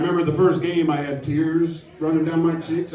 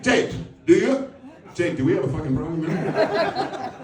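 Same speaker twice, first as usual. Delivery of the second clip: mock tough-guy kid; 7 semitones higher than usual. Son squaring up to Jake over the booster seat, mock menacing. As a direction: square up to Jake like a tiny bully. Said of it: "he's like".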